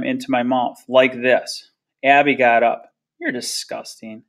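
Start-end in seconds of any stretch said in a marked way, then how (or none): 3.2-4.2 low-volume disgust, much softer. A narrator's reading. Sister leaving the table, quietly disgusted. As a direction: say it under the breath with contempt.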